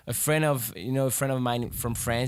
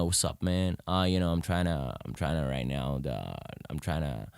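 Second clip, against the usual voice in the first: very low, quieter American accent; 9 semitones lower, 5 dB quieter than usual. Shown as a demonstration. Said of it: "do you do an American accent".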